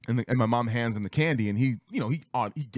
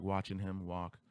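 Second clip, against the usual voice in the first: ordinary talk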